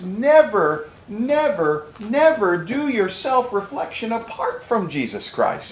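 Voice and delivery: each never hit, then a slow "never do your self-reflection"; stress on never. Acted. Preacher urging brothers never to self-reflect apart from Christ, slow emphatic. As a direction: hammer the word never, slowly. Pleading warning to congregation.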